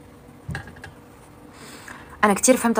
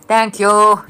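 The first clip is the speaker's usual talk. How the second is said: louder, bright burst of thanks; 2 semitones higher, 11 dB louder than usual. Shown as a demonstration. Thanking viewers for gifts, loudly. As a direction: call out a big thank-you.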